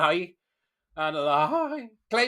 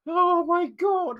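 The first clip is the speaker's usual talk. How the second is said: slow, much higher oh my God; disbelieving gasp; 11 semitones higher, 5 dB louder, much slower than usual. Real, not acted. Host seeing the dog owner's Instagram, slow high disbelief. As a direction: let the disbelief stretch out.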